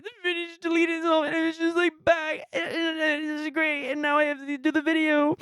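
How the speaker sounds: high, strained weepy voice; high crying voice. Retold or imitated. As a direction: talk through happy tears, pitched high. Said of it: "I was just like".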